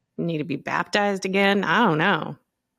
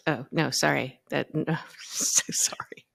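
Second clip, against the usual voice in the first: softer, whispered apology; whispering; 5 semitones lower, 6 dB quieter than usual. Real, not acted. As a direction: hush it through a laugh.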